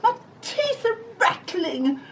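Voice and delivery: very high, stammering frightened voice with giggles; scared cook. Acted. Cook asked to dig, very high stammering fright. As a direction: quaver it, heart thumping. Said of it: "the cook declared".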